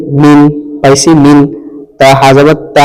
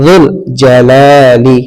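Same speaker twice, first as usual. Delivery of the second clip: slow, drawn-out "যুল জালালি"; careful slow recitation; slow blending; much slower than usual. Shown as a demonstration. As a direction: join "যুল জালালি" slowly for learners. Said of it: "এটা ভালো করে খেয়াল করবেন".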